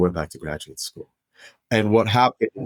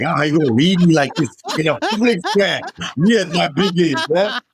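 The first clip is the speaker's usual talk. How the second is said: mock protest; 7 semitones higher than usual. [name] protesting to Dave, high mock indignant voice. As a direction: act wounded at being called out. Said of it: "I'm like".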